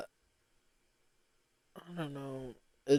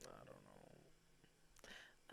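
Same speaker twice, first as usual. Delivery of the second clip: breathy whispered mumble; 38 dB quieter, much slower than usual. Real, not acted. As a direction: let it fade to a whisper. Trailing off bored, near silent.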